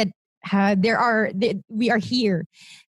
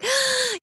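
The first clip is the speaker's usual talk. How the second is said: gasp; 11 semitones higher than usual. Retold or imitated. Awestruck at spotting a warrior.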